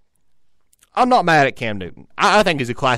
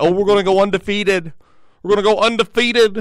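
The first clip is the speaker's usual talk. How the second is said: mock Boston accent; 8 semitones higher, 5 dB louder than usual. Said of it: "don't know how the Boston accent works".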